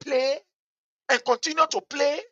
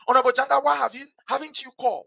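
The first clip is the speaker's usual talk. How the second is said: usual speaking voice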